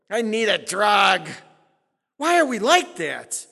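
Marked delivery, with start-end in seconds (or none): 0.1-1.3 mock whine. Mocking the depressed believer.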